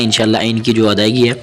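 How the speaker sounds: ordinary delivery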